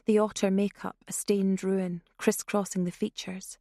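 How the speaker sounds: plain reading voice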